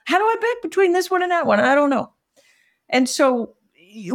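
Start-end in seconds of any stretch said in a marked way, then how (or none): 0.0-2.1 high, helpless whine; mock bewildered customer; pitched-up overwhelmed questions; confused buyers. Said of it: "And they're like".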